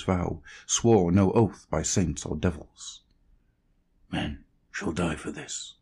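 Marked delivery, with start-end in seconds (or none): 4.1-5.7 low and much softer, cold and even; quiet cold vow; coldly. A narrator's reading. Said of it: "he said, coldly".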